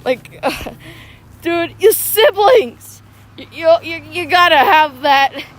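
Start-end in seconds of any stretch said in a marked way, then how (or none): 1.4-3.0 slow, loud, higher "your siblings!"; emphatic exclaim. Real, not acted. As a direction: stretch it out in mock outrage. Excited rant about siblings.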